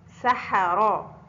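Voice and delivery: سحرا said very slowly, each sound clear; very slow classroom model of سحرا; slow example word. Shown as a demonstration. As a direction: draw out سحرا for the pupils. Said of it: "حاء في وسط الكلمة".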